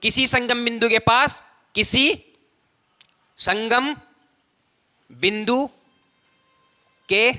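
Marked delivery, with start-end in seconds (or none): 1.7-7.3 one word at a time, long pauses between; word-by-word dictation. Real, not acted. Teacher writing out the definition for students, halting word-by-word dictation. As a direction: dictate slowly, each word left hanging.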